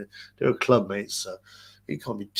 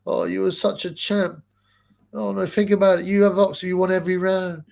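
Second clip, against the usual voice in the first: lightly voiced gushing sycophant, higher; mock fawning pundit; 7 semitones higher, 4 dB louder than usual. Mocking a pundit flattering the beaten fighter, higher gushing voice. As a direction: gush with hammed-up flattery. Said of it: "It's like Spencer Fearon".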